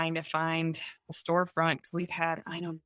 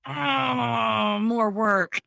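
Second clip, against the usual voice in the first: mock dismay; 5 semitones higher, 4 dB louder, much slower than usual. Told six more months work.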